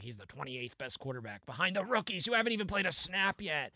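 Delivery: hammed-up imitation of a scornful commentator; Tim Benz. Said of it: "really great Tim Benz impression".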